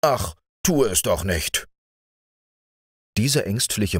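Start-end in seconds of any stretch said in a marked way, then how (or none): none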